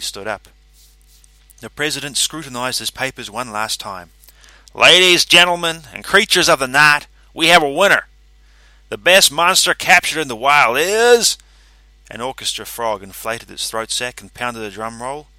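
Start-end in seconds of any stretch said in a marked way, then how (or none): 4.7-11.4 high, loud showman's proclamation; grand announcer. A narrator's reading. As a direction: build suspense for the crowd. President announces winner.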